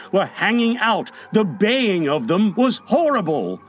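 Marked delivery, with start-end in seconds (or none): none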